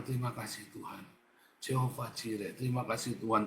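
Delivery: hushed thanks to God; soft prayer. Pretend. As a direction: murmur it like a prayer in bed. Voicing a night-time prayer. (doa lirih penuh syukur)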